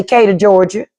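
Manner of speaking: "Decatur" cut to KAY-duh; "Decatur, Georgia" as KAY-duh Georgia; dropped first syllable. Shown as a demonstration. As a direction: leave the De off "Decatur". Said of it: "don't even say the D".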